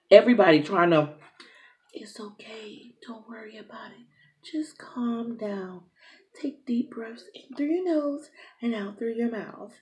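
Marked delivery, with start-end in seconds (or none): 1.9-9.7 imitating staff in a slow, hushed whisper; soothing whisper. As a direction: whisper calm reassurance, stretched out slowly. Staff soothing her, retold.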